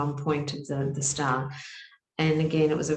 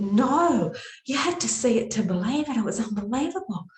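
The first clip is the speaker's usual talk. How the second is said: amazed insistence; 6 semitones higher than usual. Retold or imitated. Group telling the leader, amazed and insistent. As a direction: insist it was unbelievable.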